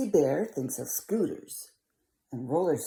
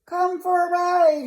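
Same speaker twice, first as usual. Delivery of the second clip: high rabbit voice; 13 semitones higher, 9 dB louder than usual. Acted. Hoppity Rabbit voiced in story.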